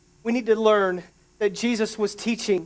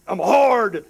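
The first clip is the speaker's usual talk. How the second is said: gruff, louder tough-guy pose, self-mocking; 4 dB louder than usual. Preacher jokingly claims he is tough, mock tough-guy voice. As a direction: play the hard man, tongue in cheek. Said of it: "I'm sorry, I'm not emotional".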